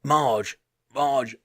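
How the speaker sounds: plaintive mock German calling; imitated German accent, the name drawn out. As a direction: call for someone slowly in a German accent. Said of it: "it was in German accent".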